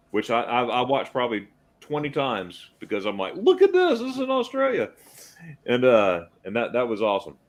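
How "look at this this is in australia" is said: excited. Retold or imitated. Excitedly quoting his own reaction.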